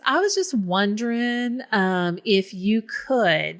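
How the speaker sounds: put-on drawn-out Southern accent, coaxing a favor; Southern accent, lingering on the request; indistinct Southern accent. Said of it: "not my Tennessee family's accent".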